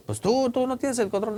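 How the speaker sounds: put-on older brother retort. El amigo responde al hermanito.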